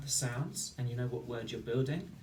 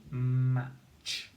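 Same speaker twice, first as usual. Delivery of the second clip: «We have match» very slow and drawn out; slow sounding out; 6 dB louder than usual. Shown as a demonstration. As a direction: stretch «match» slowly for spelling. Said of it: "let's see".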